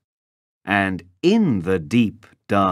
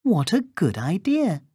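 put-on high little-girl voice; 10 semitones higher than usual. A narrator's reading. Girl tells the wolf her errand, high childlike voice. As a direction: pitch it way up like an innocent little girl.

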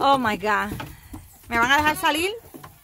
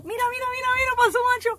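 much higher, sing-song pet voice; excited cooing; 13 semitones higher, much faster than usual. Real, not acted. Owner cooing to goats in the pen, high and excited.

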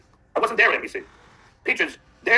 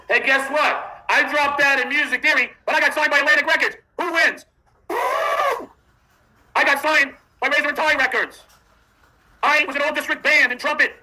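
mocking braggart; 6 semitones higher than usual. Braggart rant, higher and louder.

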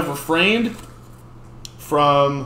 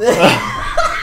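embarrassed laugh; 4 semitones higher, 6 dB louder than usual. Real, not acted. Laughing at her own outburst.

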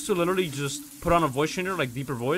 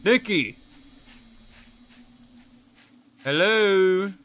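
louder, higher search call; raised voice calling across the map; 4 semitones higher, 5 dB louder, much slower than usual. Real, not acted. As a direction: shout it out to find her. Calling for lost teammate.

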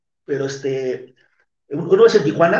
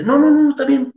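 much higher, hurried no no no; flustered reassurance; 10 semitones higher, 4 dB louder than usual. Retold or imitated. Reassuring the frightened woman he won't hit her, flustered high voice. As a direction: rush to calm her down.

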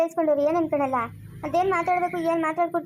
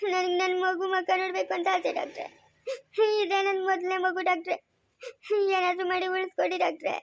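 crying plea; 2 semitones higher than usual. Acted. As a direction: beg the doctor between sobs. Weeping plea to the doctor.

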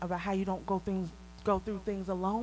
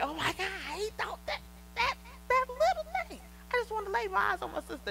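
much higher, emotional outpouring, fast at the end; overcome with emotion; 13 semitones higher than usual. Retold or imitated. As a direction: relive being moved nearly to tears. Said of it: "literally was almost in tears".

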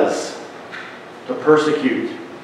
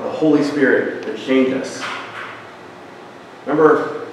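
ordinary talk

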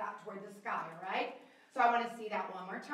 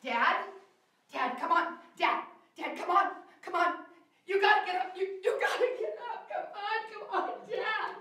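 building from raised pleading to high screams for help; upset pleading; 9 semitones higher, 9 dB louder, slower than usual. Shown as a demonstration. Director showing a child how to beg Dad to get up, building screams. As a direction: plead harder and harder until you are screaming. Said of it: "The part I just did for you".

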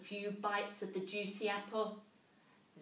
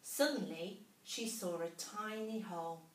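usual speaking voice

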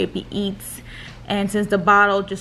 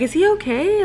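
worried doctor quote; 10 semitones higher than usual. Retold or imitated. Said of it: "Like, I'm getting kinda concerned".

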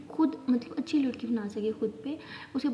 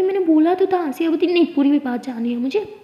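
raised, insistent voice; 5 semitones higher, 8 dB louder than usual. Acted. Tara insisting to Siya, voice raised. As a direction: push back loudly and insistently. Sisters arguing, retold.

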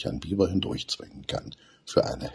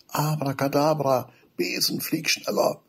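higher, chanted spell; magic spell call; 9 semitones higher than usual. A narrator's reading. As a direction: cast the spell with flair. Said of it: "und ruft". (Zauberspruch rufend)

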